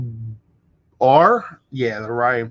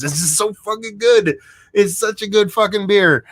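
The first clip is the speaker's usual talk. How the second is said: higher, gushing praise; thrilled beer raving; excited rave; 9 semitones higher, 4 dB louder than usual. Real, not acted. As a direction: rave about it, pitch up. Raving about the beer after tasting.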